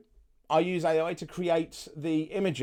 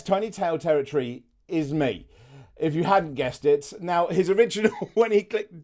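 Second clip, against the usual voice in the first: normal talking voice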